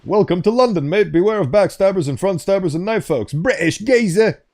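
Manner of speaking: put-on London accent. Streamer greeting newcomers to London in a hammed-up London accent. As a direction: put on a high, hammy Cockney welcome. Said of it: "do a beautiful London accent".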